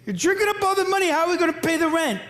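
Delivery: high, sharp scolding mother's voice; mom scolding. Retold or imitated. Mother scolding her drinking husband over the rent, pitched high. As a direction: nag him about the money, pitched high.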